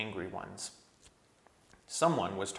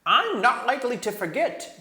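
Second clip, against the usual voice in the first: much higher, loud furious dwarf; angry dwarf; 10 dB louder than usual. A narrator's reading. Nickabrick snapping at the king, high and furious. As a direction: spit it out with bitter rage. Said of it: "came the angry voice".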